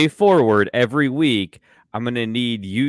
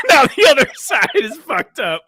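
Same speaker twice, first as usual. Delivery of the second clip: high, loud words through laughter; real laughing fit, pitched way up; helpless laughter; 6 dB louder than usual. Real, not acted. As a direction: talk while cracking up. Cracking up at the accents.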